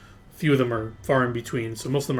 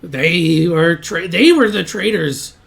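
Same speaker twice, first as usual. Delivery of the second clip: loud, higher goblin protest; indignant captive goblin; 5 semitones higher, 9 dB louder, slower than usual. Acted. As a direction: throw the accusation back forcefully. Captive goblin defends himself when questioned.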